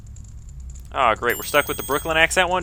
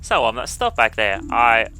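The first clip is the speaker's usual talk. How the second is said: put-on awkward apology in English accent; hesitate, then wheedle in a mock English accent. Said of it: "I wanna do the English accent".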